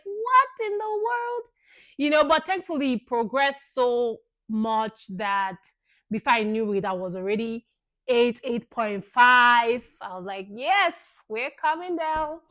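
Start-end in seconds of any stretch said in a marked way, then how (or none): 0.0-1.4 high, drawn-out exclamation of disbelief; shocked disbelief. Retold or imitated. Mother retelling her reaction to slow labour progress, shocked disbelief. 10.5-12.4 higher-pitched, pleased little cheer; relieved cheer. Retold or imitated. Mother reliving good news on dilation, mildly excited cheer. Relived joy at progress in labour.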